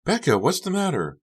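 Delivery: high, concerned friend; Carrie's high girl voice. Acted. Friend finds Becca upset, asks high and worried.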